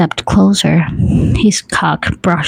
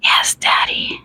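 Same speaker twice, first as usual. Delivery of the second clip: soft whisper; hushed, whispered answer; breathed reply; 5 dB quieter than usual. A narrator's reading. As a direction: breathe it out softly, almost voiceless. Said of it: "I breathed".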